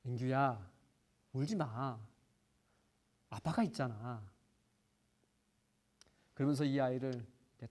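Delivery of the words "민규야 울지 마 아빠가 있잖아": soft, low comfort to a crying son; reassuring father. Retold or imitated. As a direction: gently soothe your little boy. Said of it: "제가 뭐라고 얘기했냐면". (우는 아들을 달래는 아빠의 부드럽고 낮은 목소리)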